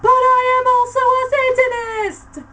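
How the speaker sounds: huge, high shouted reveal; hammy stage actor. A narrator's reading. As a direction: declaim it like a villain. Hagrid's dramatic reveal.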